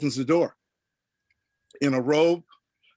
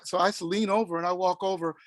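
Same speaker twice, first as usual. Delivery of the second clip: ordinary delivery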